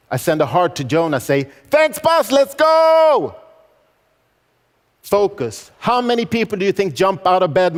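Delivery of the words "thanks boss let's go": high, loud rallying holler; hollered thanks turning into a battle cry; war cry shout. Retold or imitated. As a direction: shout it upward and hold the last word. Repeats war cry to Jonah.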